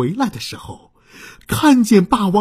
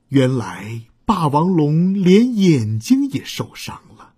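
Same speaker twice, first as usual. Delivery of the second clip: ordinary delivery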